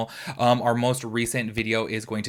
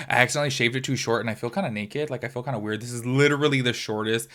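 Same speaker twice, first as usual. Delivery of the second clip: ordinary delivery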